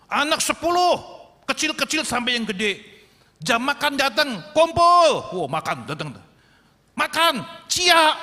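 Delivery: put-on high, loud call to the children; mother calling her ten children in for their meal. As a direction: holler the kids in to dinner. Mother calls ten kids to eat.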